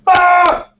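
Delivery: a sudden high yelp of shock; startled shout. Retold or imitated. Audience member jumps at the pitch with a startled shout. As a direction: jolt them with a high cry. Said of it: "People just kinda go".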